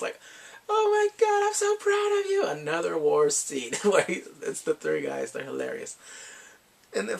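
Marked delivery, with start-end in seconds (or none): 0.7-2.4 loud, high falsetto squeal; gushing mock pride. Online friends hearing he's invited over, hammed-up pride. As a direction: squeal congratulations in a put-on high voice. Everyone thrilled about date at hers.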